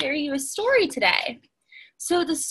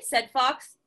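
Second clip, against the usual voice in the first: ordinary delivery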